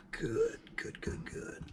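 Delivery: breathy whispered good, good; savoring whisper. Real, not acted. Savoring first bite.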